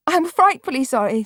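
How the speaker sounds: put-on a girl apologising in tears. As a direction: plead sorry through tears. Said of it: "said Marianne in tears".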